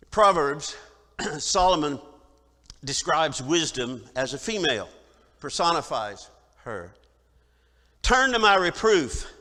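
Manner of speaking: loud, emphatic scripture reading; preaching. Acted. Preacher reading Proverbs to the congregation, emphatic preaching. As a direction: announce the proverb from the pulpit. Pastor introduces and reads Proverbs.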